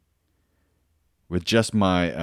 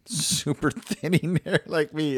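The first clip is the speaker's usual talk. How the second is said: high, laughing self-deprecation; 11 semitones higher than usual. Real, not acted. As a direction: laugh through the words, high and drawn out. Laughs at own thinning hair.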